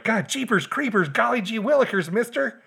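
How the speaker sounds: put-on high, eager gee-whiz kid voice; golly-gee all-American boy; wholesome 1950s kid. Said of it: "Henry's like".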